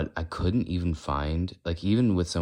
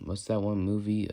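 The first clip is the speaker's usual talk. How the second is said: ordinary delivery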